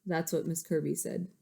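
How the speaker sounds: usual speaking voice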